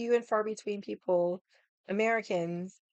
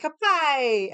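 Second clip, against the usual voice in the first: loud toast; 6 semitones higher, 7 dB louder than usual. Retold or imitated. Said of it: "I was like".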